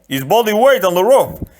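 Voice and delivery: a big polite man, puzzled; giant voice. Acted. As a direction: giant complaining to the Sicilian on his neck. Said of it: "Fezzik said".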